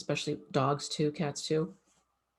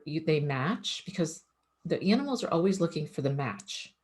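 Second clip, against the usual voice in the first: ordinary talk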